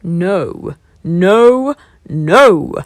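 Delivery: loud thundering no; lion thunders refusal; thundered. A narrator's reading. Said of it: "thundered the lion".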